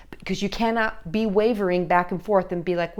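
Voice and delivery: normal talking voice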